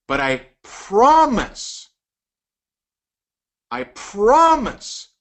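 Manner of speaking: slow, high "promise" drawn out; stressed promise. Shown as a demonstration. Sales coach drawing out the word to his audience, slow stress. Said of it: "When I say the word "I promise".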